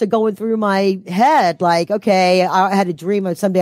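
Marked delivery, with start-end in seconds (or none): none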